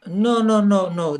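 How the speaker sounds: ordinary delivery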